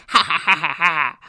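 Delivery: imitated cackly laugh, loud and staccato; maniacal cackle. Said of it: "his maniacal laugh. It was like a cackly".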